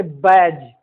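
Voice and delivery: बैज said with no D sound, like "baj"; बैज, the D stays silent; silent D in badge. Shown as a demonstration. Teacher showing the silent D in बैज. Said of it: "न कि बैडेज".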